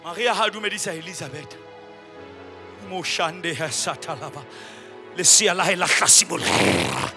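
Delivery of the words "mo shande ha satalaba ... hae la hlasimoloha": normal talking voice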